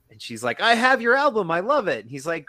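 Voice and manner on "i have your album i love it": high, loud imitation of a thrilled fan; retold excited shout. Actress calls to comedian she admires, mock excited shout. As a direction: gush at full volume, playfully hammed-up.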